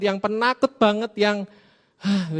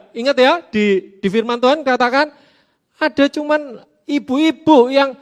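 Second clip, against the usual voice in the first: ordinary talk, nothing marked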